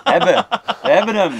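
high, loud triumphant cheer; gleeful we-got-it shout; excited cheer. Real, not acted. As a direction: celebrate the take being done. Celebrating the finished take.

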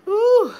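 high, loud squeal of delight; loud high ooh of pleasure. Real, not acted. Delighted exclamation.

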